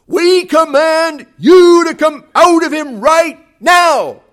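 a slow, high, loud shouted command. Retold or imitated. Disciples shout at the demon, slow and commanding. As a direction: bellow the order with everything you have.

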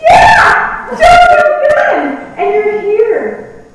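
high, loud cheering yell; joyful shout. Pretend. Parents cheering her arrival in heaven, high joyful shout. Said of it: "my mom and dad go".

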